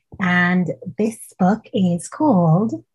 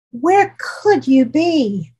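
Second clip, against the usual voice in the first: slow worried question; 7 semitones higher, slower than usual. Acted. Child searching for monster.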